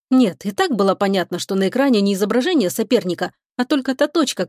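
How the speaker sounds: plain reading voice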